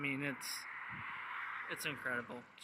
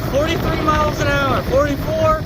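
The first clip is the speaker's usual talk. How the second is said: excited; 9 semitones higher, 6 dB louder than usual. Real, not acted. Calling out speed while riding.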